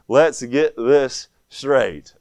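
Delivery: very slow, deliberate delivery; slow, firm admonition. Acted. Brother halting a sister before a kiss, slow and firm. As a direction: draw it out word by word to call a halt. Acted firm halt to a woman.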